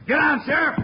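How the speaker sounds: very high, loud yell; urgent warning shout. Acted. Bill warns the sheriff of a rifleman, urgent yell. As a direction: shout a warning across the street.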